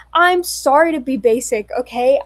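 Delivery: loud mock-defiant apology; playful yell. Streamer yelling a cheeky apology at her chat, loud and playful. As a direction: yell it out, half joking. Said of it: "why I'm yelling".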